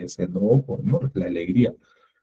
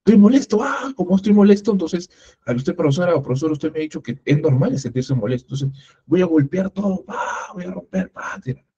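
angry student; 7 semitones higher than usual. Pretend. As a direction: play a student throwing a fit. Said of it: "regula tu emoción".

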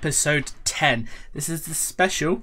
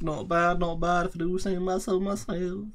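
a hint of a put-on self-satisfied voice, higher; mock smug; 6 semitones higher than usual. Praising his own case drop, mock smug. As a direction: pat yourself on the back, lightly hammed.

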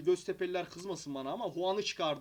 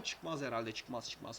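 low, soft resigned aside; muttered doubt; 10 semitones lower, 11 dB quieter, slower than usual. Real, not acted. Doubting the attack to himself, quiet aside. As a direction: mumble it to yourself.

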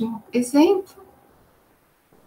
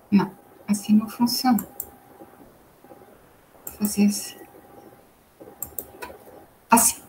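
quick whisper to herself; fast whispered self-correction; whispered aside; 3 semitones lower, 6 dB quieter, faster than usual. Real, not acted. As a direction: mutter it under your breath while fiddling. Aside while fixing the screen.

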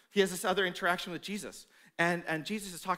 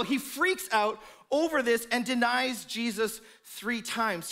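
ordinary talk

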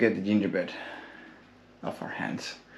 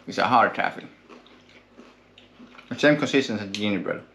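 talking between bites; chewing while talking. Real, not acted. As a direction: mouth partly full. Seen 1: Man in red holds candy at mouth, jaw working throughout.

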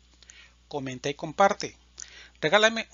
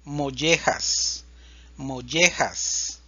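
Mollejas, slowly, LL as a y sound, j as a breathy h; drawn out for learners to copy; Mollejas, lento y claro; slow careful Mollejas; slower than usual. Shown as a demonstration. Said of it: "El sonido de la doble L".

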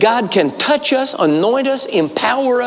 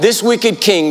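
usual speaking voice

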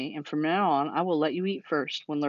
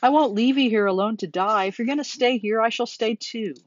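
raised, insistent, pleading pitch; distraught shout; 5 semitones higher, 4 dB louder than usual. A narrator's reading. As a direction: cry out in stubborn loyalty, loud at first. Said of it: "shouted Wilbur".

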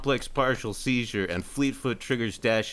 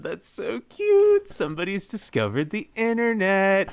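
gushing Twilight; 8 semitones higher, 4 dB louder than usual. Retold or imitated. Twilight mocking Glimmer, high cooing gush. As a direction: coo it like over a baby. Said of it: "And Twilight's like".

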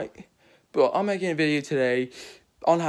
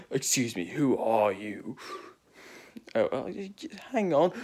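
lightly put-on British accent, Doctor Who scene; playful Doctor Who reenactment in a British accent. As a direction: act out the Doctor and his companions in a British voice. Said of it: "Why am I having a British accent".

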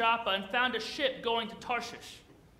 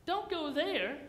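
no jumps much higher in protest; startled listeners refusing in a much higher voice; alarmed no; 8 semitones higher, much slower than usual. Retold or imitated. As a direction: recoil at the very idea. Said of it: "We'd have been like".

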